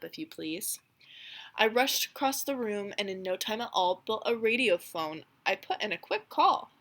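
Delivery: put-on lively read-aloud narration for children; storybook reading. A narrator's reading. Teacher reading The Lorax to her class, lively storybook voice. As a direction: read it like a picture book to a class. Reads Once-ler and Lorax dialogue.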